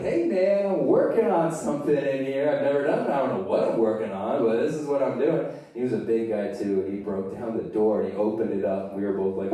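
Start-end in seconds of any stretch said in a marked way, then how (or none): 0.0-5.5 whiny protest. Acted. Teen protests at being interrupted.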